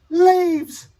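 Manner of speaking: high-pitched imitation of crying wonder; mock tearful. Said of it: "and I'm crying".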